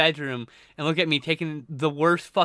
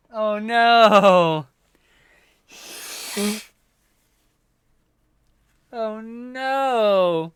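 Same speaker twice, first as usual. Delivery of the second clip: higher drawn-out cry; mock dismay; 6 semitones higher, 4 dB louder, much slower than usual. Real, not acted. Streamer reacting to Raptor's message. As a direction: groan it in sympathy. Repeated sympathetic cries.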